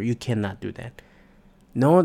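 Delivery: usual speaking voice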